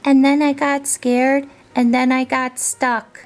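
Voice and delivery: high-pitched little creature; little monster explaining his plight; small monster voice. A narrator's reading. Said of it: "said in his small, soft voice".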